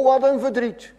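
ordinary talk, nothing marked

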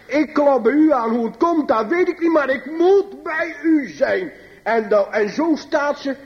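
high, drawn-out pulpit plea; fervent preaching. Acted. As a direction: preach it slowly and high, pleading at the door. Preekt met volle kracht. (vurig preken, hoog en gedragen)

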